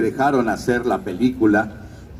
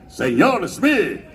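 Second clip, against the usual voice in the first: llamado pirata alargado, tono agudo; James Garfio; 4 semitones higher, much slower than usual. Shown as a demonstration. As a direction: grita con voz impostada, estirando las sílabas. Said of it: "fue que yo pude gritar". (grito impostado de Garfio)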